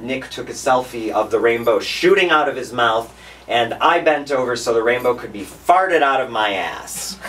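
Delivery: loud, high and rousing; exuberant storytelling. Real, not acted. Storyteller recalls a rainbow-lit night for the crowd, loud and exuberant. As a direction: belt it out to the crowd like a celebration.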